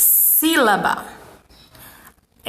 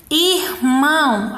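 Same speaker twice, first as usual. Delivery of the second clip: IRMÃO slowly, ir, then mão; split into syllables; much slower than usual. Shown as a demonstration. Teacher pointing out vowels, irmão split in two. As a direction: separate the two halves of irmão. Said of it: "na primeira sílaba tem uma vogal".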